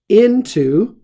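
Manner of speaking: "Into" punched high and loud; stressed into. Shown as a demonstration. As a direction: hit "Into" hard to make the point. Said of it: "remember, in plus accusative".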